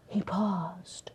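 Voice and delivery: hushed whisper; soft whispered suspense. A narrator's reading. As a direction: drop to a secret whisper. Dramatic pause in story.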